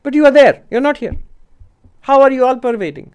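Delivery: pitched well up, pressing the point; acted-out puzzled objection, high and insistent; challenging objection. Monk tells the teacher he cannot be everywhere, challenging objection.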